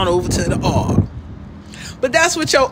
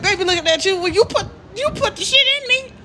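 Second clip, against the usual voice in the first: put-on baby's voice; 11 semitones higher, faster than usual. Voicing the baby complaining.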